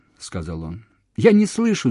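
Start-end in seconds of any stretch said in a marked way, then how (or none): 1.2-1.9 high, loud desperate cry; shout. A narrator's reading. Woman losing him on the phone, shouting. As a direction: shout down a failing line. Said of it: "закричала она".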